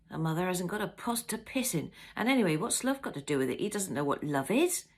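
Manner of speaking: ordinary delivery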